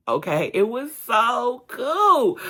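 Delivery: high, loud, drawn-out enthusiasm; real excitement. Real, not acted. Telling viewers about a most fulfilling experience, loud excitement. Exclaiming over thrilling snorkel experience.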